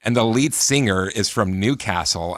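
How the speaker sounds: ordinary delivery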